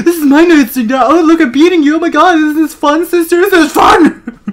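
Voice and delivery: very high, loud, hammed-up taunting; mock manic glee. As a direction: squeal it like a gleeful attacker. Voices Faith gleefully beating someone.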